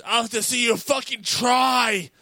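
retold furious yell, loud and high; angry shout. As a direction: bark it back like a mad boss who finally snaps. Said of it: "only time ever I've seen Dave mad".

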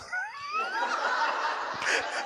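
real high-pitched laugh; high burst of laughter. Real, not acted. Pastor laughs at his own story, high real laugh.

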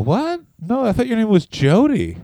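confused wrestler caught out; hammed-up bafflement; mock surprise. Billy confused about her name.

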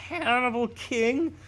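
high-pitched put-on voice, mocking; mock self-important request. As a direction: mimic someone announcing a silly new name. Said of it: "and you're like".

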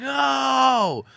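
high, loud held "oh"; big drawn-out reaction shout; imitated crowd roar. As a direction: mimic a crowd erupting at a punchline. Retold crowd reaction to his bars.

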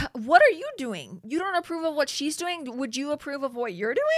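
high-pitched indignant comeback. Real, not acted. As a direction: throw his words back at him, high and indignant. Indignant rant at contestant.

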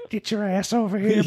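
put-on high, laughing creepy old man; leering old man, half laughing.

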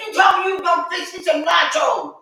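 retold yell. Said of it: "I said".

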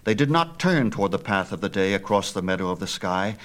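ordinary delivery

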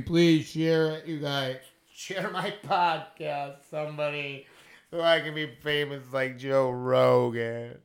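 slow, drawn-out pleading in a put-on voice; mock begging. Begging listeners to share his podcast, slow mock pleading. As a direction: whine it out like a desperate beggar. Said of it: "They're begging".

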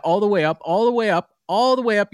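all the way up, rising each time; building up. Retold or imitated. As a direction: push it up with every repeat. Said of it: "poor British accent".

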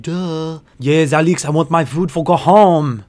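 mock condescending customer; lightly voiced smug customer. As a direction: talk down to her like she's an idiot. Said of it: "He says it with this tone, implying that I am a fucking idiot".

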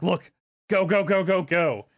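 high, loud frantic urging; urgent go go. Pretend. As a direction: spiders cheering each other on. Spiders voiced rushing for mouth.

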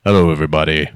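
low, booming YouTuber greeting; deep intro voice. Retold or imitated. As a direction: drop the voice for a big intro. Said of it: "Take off the mask".

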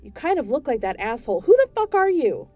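enraged homeowner yelling at ghost; furious yelling. Acted. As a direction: scream at the intruder to get out.

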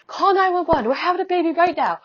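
mild mock shout, pitched high; retold shout. Dad calling to husband to phone 911, high mock shout. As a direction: call it out like dad down the stairs. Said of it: "my dad shouts out to my husband".

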